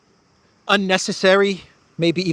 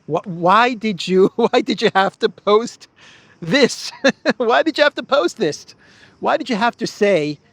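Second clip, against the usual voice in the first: rising higher and louder through laughter; laughing disbelief; 6 semitones higher, 4 dB louder than usual. Real, not acted. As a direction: laugh it out, asking why in disbelief.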